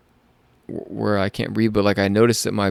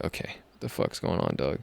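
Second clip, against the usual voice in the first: hushed, nearly voiceless retold reaction; baffled undertone; 3 semitones lower, 11 dB quieter than usual. Confused patient reacting to the ER news, hushed. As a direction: say it under your breath, as if to yourself. His baffled reply to the staff.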